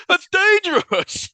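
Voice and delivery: high, loud, slow mock warning; mock shout. Job shouting a warning at the smoker, high and loud. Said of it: "Joe's like".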